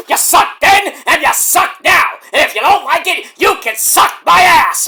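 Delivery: high, loud, slow taunting promo; Shane Douglas. Retold or imitated. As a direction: trash talk like a ranting wrestler. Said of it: "calm down, Shane Douglas".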